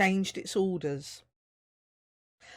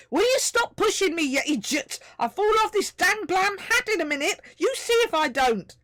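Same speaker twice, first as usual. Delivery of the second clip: high, loud, annoyed little voice; irritated tiny voice; 13 semitones higher, 6 dB louder than usual. A narrator's reading. Tiny voice shoved on a hat complains: irritated. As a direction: snap at the one pushing you. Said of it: "came the irritated response".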